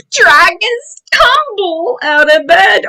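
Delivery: usual speaking voice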